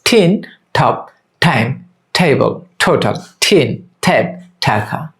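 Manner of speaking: slow spaced words, puff of air on t in "টিন, টপ"; aspirated T drill. Shown as a demonstration. Teacher drilling t as ঠ, slow aspirated "টিন, টেবল". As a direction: model "টাইম" slowly with an aspirated t. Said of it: "একইভাবে টি দিয়ে ঠ".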